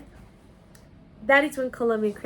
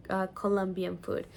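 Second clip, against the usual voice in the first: usual speaking voice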